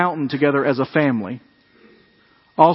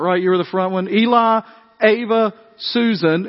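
ordinary talk, nothing marked

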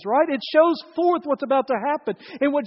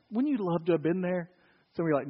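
normal talking voice

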